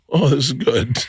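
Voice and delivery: lightly voiced impressed parents; a hint of approving immigrant parents; Chinese parents. Parents watching the Chinese dub, mildly impressed. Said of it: "and they're like".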